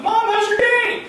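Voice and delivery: nervous too-bright greeting; fake casual. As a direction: play innocent to distract mom. Hiding the dildo from mom.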